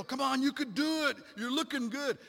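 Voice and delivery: high, loud cheering on; encouraging shout. Retold or imitated. Dad cheering runners as they climb the hill, high encouraging yell.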